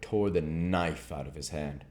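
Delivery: plain reading voice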